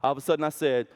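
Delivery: normal talking voice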